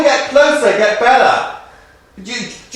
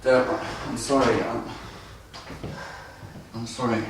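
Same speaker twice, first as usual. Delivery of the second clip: quiet; 14 semitones lower, 20 dB quieter than usual. Acted. Man apologizes softly, broken.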